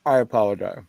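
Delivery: talking through a mouthful; mouth full. Real, not acted. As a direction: say it while chewing. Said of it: "With my mouth full".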